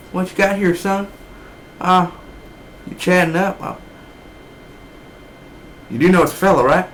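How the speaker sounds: lightly voiced old man ribbing him; imitating Bosworth. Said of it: "he was literally like".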